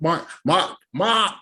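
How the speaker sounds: hammed-up Boston accent, high; bad Boston "Mark" with the r dropped. Shown as a demonstration. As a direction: call the name in a mock Boston accent. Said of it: "That's my Boston accent".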